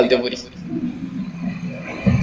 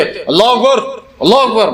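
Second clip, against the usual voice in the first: bossy takbir aimed at the imam; as a command; 3 semitones higher than usual. Shown as a demonstration. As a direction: call it out like an order to the imam. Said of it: "یہ حکم ہے".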